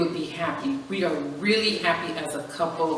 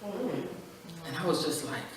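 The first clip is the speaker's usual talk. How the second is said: normal talking voice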